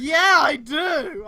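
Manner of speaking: loud, slow, eager yes; eager shout. Real, not acted. Streamer eager to see the worst fan art, excited shout. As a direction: jump at the offer.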